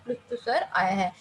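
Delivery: usual speaking voice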